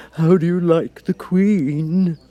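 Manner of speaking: a sly cat asking in confidence; low voice. Acted. The Cat asks Alice about the Queen, slow and sly. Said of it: "said the cat in a low voice".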